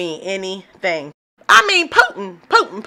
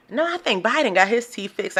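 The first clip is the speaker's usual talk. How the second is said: usual speaking voice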